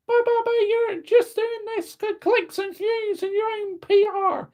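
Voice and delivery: squeaky high-pitched mock heckler voice, stammering but but but; whiny critic. Imaginary viewer scolds Joshy for negativity, in a squeaky mock voice. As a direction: play a nagging fan scolding him in a silly high voice.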